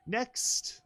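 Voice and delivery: high rising call of next. Acted. Counting items, high rising call. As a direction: sing out Next brightly. Calling next item, raised.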